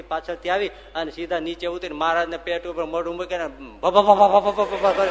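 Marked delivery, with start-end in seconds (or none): 3.9-5.1 loud playful biting bursts; bite-bite-bite noises, loud and quick; excited biting noises. Retold or imitated. Premanand rushing to bite Maharaj's belly. (જોરથી બચકાં ભરવાનો અવાજ)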